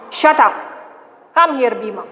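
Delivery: acted headman cutting the laughter off; angry command. Headman silencing his workmen, acted angry command. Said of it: "shouting in anger".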